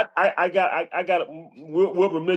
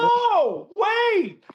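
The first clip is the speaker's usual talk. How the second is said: high shouted whoa, wait; stopping the coach, high startled shout; retold alarm. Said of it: "And I was like".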